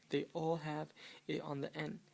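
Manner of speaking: normal talking voice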